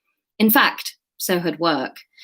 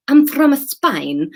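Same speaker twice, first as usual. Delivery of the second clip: slow, higher Spanish accent attempt; badly faked Spanish accent, drawn out; 8 semitones higher, 5 dB louder, much slower than usual. Shown as a demonstration. Trying out a voice for a new character, shaky-footed Spanish accent. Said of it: "Let's do Spanish".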